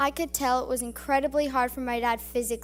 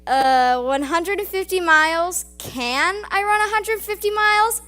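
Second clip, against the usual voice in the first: incredulous; 6 semitones higher, 7 dB louder than usual. Pretend. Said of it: "I would have been like".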